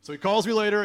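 usual speaking voice

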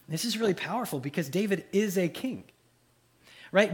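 ordinary talk

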